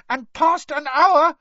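slow, loud, very high elf recital; ominous squeaky elf chant; Dobby squeaking. A narrator's reading. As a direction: squeal out the warning word by word. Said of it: "squeaked Dobby".

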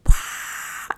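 breathy, voiceless mock crowd cheer; imitated crowd roar. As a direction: fake a stadium roar on a whispered breath. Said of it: "and the crowd goes wild".

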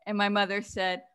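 ordinary delivery

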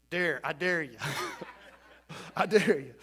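a laughing dare to the boss. Acted. Medic daring command to write him up, laughing. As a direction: chuckle through the dare.

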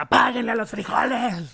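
high, loud yelled order; high mock shout. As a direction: bark it like an angry star. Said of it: "Y luego dijo".

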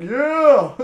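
loud, high whoop breaking into laughter; elated cheer. Retold or imitated. Missionary reads he can stay, retold elated cheer. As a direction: let out a triumphant shout.

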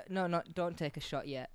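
usual speaking voice

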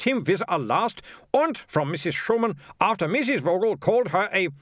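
high, indignant comic tirade; snappish mayor ranting. Acted. Mayor lists townsfolk's complaints to sergeant, high and indignant. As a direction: pile up each complaint, pitched high.

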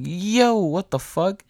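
high-pitched drawn-out "yo"; startled disbelief. Retold or imitated. Storyteller relives hearing banging on the door, startled. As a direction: re-enact being startled, pitch jumping up.

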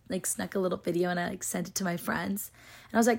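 ordinary talk, nothing marked

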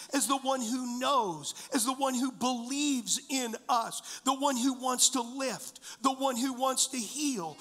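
ordinary talk, nothing marked

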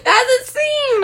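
high, slow, giggling spooky line; laughing spooky voice. Pretend. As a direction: ham up a ghostly intro through laughs. Corrects the spooky intro line.

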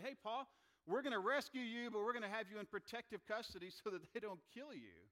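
loud, high-pitched urgent announcement; Roman rescuer. Acted. Roman soldiers to Paul as they take him into custody, loud rescuer. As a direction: call out the rescue plan over a commotion.

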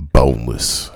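loud, falling boneless. Pretend. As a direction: shout the word boneless. Caller repeats word even louder.

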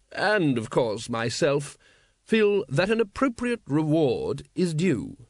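slow, drawn-out aristocratic ruler; suave patrician. Acted. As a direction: drawl it like a bored, polished tyrant. Patrician's voice in narration.

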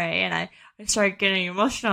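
ordinary delivery

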